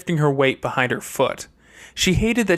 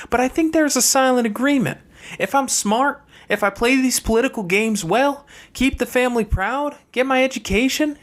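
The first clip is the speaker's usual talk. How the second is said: higher sister's voice, cool and matter-of-fact; a younger sister laying out hard truths, voiced higher; Fernanda's voice; 8 semitones higher than usual. Fernanda explains her place to brother Chase, higher sister voice.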